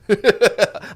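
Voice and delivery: laughing. Real, not acted. Laughing off crying.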